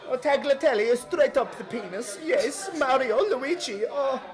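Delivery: hammed-up Italian moaning with delight; Italian accent. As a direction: play the lecherous Italian, high and savouring. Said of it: "with a little Italian accent".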